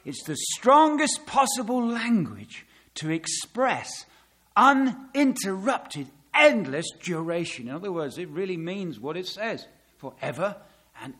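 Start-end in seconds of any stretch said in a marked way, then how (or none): 0.0-7.7 quoting the scholar. Retold or imitated. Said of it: "let me tell you what he says".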